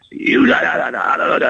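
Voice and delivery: high-pitched babbled rant; mock chewing-out. Coach going at a player's neck in the locker room, mock rant. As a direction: imitate a coach ranting in nonsense syllables. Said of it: "he was just going at my neck".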